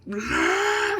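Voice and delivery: high, loud grieving cry; Chewie's anguished no. Retold or imitated. Chewie sees Han killed, high loud howled no. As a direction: wail it as Chewie watching Han die. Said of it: "Chewie, when Han died, be like".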